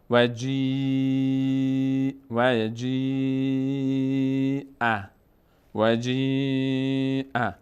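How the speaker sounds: "و جی" with a very long ee; elongated ya. Shown as a demonstration. Teacher showing ya with madd, drawn out. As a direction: hold "جی" level on one note.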